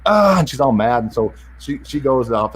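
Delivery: ordinary talk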